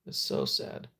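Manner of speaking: soft whisper of real sympathy. Real, not acted. Host whispers sympathy to viewer Kay. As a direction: breathe the words out, barely voiced. Sympathy for friend's broken arm.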